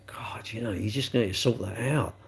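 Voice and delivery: whispered inner thought; whispering. Retold or imitated. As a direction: mutter it under breath.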